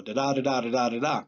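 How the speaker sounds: "da da" in pairs, second one stressed; iambic beat. Shown as a demonstration. Teacher demonstrates the regular meter, "da DA da DA". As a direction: tap out "da DA da DA" evenly. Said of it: "They don't always go this way".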